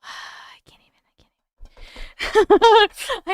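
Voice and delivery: barely audible, airless whisper; sigh, then whisper it helplessly; spooked whisper. Real, not acted. Too creeped out to talk.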